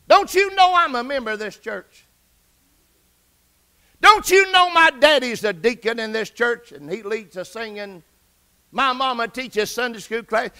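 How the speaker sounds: loud, mildly indignant protest; retold upset. Young man defending himself to his aunt, retold upset. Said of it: "I sort of got upset".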